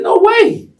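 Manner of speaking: very high squeal of disbelief; hammed-up incredulous answer; acted-out shocked refusal. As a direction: balk at it, voice shooting up. Acts out shocked answer to Oprah.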